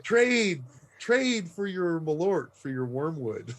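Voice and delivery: slow, halting Swedish-flavored English; broken English. Acted. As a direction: haggle in clumsy broken English. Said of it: "say in broken Swedish, broken English".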